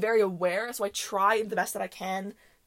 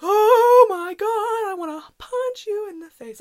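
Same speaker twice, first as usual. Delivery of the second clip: high, drawn-out wail of exasperation; exasperated outburst; 12 semitones higher, 8 dB louder, slower than usual. Retold or imitated. Fed-up onlooker thinking about the yelling girl, exasperated wail. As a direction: stretch it out high, loud at first, then pull back. Retold inner fury at the girl.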